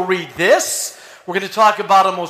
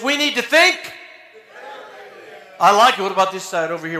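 ordinary talk, nothing marked

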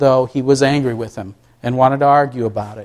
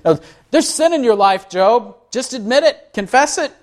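higher, pressing voice of Job's friends urging a confession; accusing friends; 8 semitones higher than usual. Retold or imitated. Friends pressing Job to confess, mock accusing tone. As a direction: lean on Job to admit his sin. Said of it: "Essentially this".

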